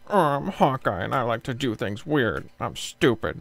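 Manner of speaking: lightly voiced dumb Hawkeye, slow and loud; a hint of goofy Hawkeye, drawn out; Hawkeye impression. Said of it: "do a Hawkeye impression".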